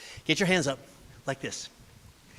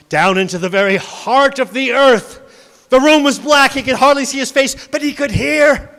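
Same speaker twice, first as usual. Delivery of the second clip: louder, higher storytelling climax; building narration; 6 semitones higher, 9 dB louder than usual. A narrator's reading. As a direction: build it to a big reveal. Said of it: "narration builds to loud".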